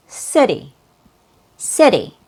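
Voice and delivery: "city" with a very quick, light D for the t; fast D. Shown as a demonstration. Teacher showing the American "city" to learners, fast D. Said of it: "a very quick D sound".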